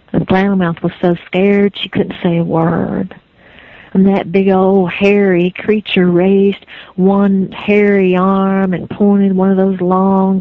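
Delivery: ordinary delivery, nothing marked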